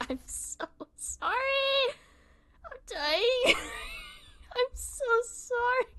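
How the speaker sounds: slow, high squealed wail; crying with laughter. Real, not acted. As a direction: squeeze the words out through helpless laughing. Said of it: "Crying, shaking, screaming".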